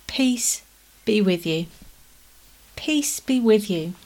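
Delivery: soft, tender offer of peace; gentle greeting. Acted. Jesus softly greeting his frightened disciples, tender and calm. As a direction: say it gently, like calming frightened friends. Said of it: "look how gentle Jesus is".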